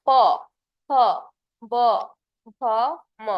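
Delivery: প ফ ব ভ ম each said alone with lips closing; teacher showing lip sounds প ফ ব ভ ম; lip consonant demo. Shown as a demonstration. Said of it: "দেখো তোমার ঠোঁট নড়ে কিনা".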